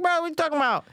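high-pitched mock incredulous quote; imitating co-host's objection. Co-host objecting to talk of a giant burger, mock incredulous. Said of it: "Mike is like".

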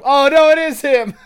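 high, loud squealing fangirl; excited shriek. Pretend. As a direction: squeal it like a giddy teen.

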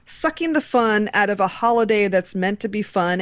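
ordinary talk

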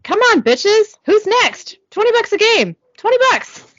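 high-pitched yell at restrained volume; mock yell. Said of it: "Ty yells in the background".

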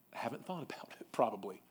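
confiding whispered tease; whispered aside. Real, not acted. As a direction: drop to a whisper for the jab. Aside about other directors.

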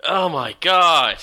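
exasperated. Real, not acted. Reacting to a pun.

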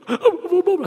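put-on high, half-hearted boy's try; timid kid. Son mumbling the phrase his dad asked for, timid. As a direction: say it shyly, not believing it. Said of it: "He goes".

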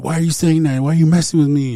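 terrified husband confronting his wife; panicked pleading. Retold or imitated. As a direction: shake her and demand an answer in fear. Flipping out.

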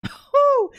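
laughing whoop, loud and high; excited whoop. Real, not acted. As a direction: let out a joyful whoop. Excited about God shifting gears.

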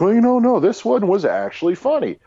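mock film defender. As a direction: imitate someone earnestly defending a flop. Voices an imagined future defender.

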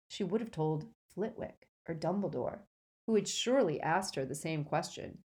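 ordinary delivery

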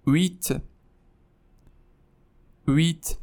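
ویت with a silent h, wi gliding into t; ویت as French huit, no h. Shown as a demonstration. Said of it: "اش تو زبان فرانسه خونده نمیشه".

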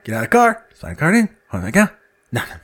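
ordinary talk

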